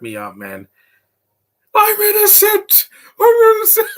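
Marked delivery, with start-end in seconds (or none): 1.7-3.9 very high, loud squealed plea; hammed-up falsetto cry; mimicked protest. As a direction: squeal it in mock desperation. Repeats the movie character's cry.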